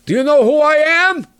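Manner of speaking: high, loud mock indignation; pompous big shot. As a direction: ham up an indignant self-important man. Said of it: "people with that kind of attitude".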